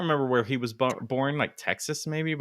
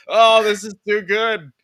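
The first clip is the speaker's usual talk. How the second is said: high, loud, drawn-out delighted reaction; retold delight; 8 semitones higher, 8 dB louder, slower than usual. Podcaster reacting to a funny thumbnail, delighted. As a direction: savour every word, loud and high.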